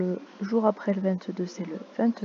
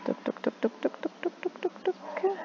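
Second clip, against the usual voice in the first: whispered toc toc rising higher and higher; wheel clicking; 10 semitones higher, 8 dB quieter than usual. Shown as a demonstration. Teacher turning the date wheel, whispered clicking. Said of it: "on va la faire tourner".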